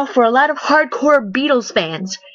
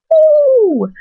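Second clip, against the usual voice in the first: a sudden high, loud falsetto "Woo!"; mock church whoop; 5 dB louder than usual. Mocking the singer's dedication to the Lord with a falsetto whoop. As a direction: whoop it up like a gospel shout.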